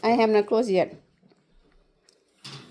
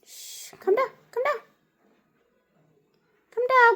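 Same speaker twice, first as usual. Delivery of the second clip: high coaxing pet voice; 13 semitones higher, 3 dB quieter than usual. Real, not acted.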